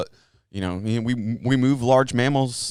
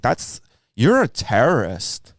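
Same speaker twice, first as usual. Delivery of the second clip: mock accusing hippie; 5 dB louder, slower than usual. Quoting crunchy listener accusing.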